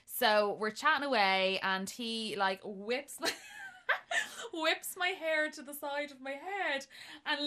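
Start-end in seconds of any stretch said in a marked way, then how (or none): none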